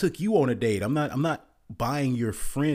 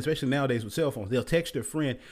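ordinary talk, nothing marked

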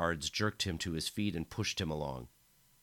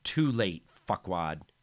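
sneering taunt; 6 dB louder, slower than usual. Acted. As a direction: spit the insult with contempt. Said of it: "The Emperor sneered".

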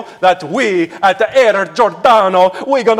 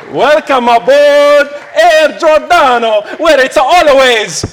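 cheerful shout; 6 semitones higher, 4 dB louder than usual. Acted. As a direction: call it out like a proud pilot. Pilot's cheerful sign-off.

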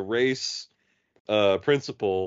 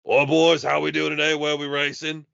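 loud cheery Australian greeting the lobby; breezy Aussie bloke; Aussie gamer voice; 5 semitones higher, 4 dB louder than usual. Retold or imitated. Captain Smash hopping into the race, loud Australian. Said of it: "he's like".

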